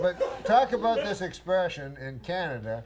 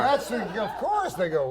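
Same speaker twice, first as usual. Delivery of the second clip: usual speaking voice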